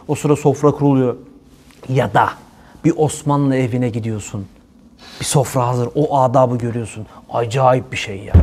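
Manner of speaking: ordinary talk, nothing marked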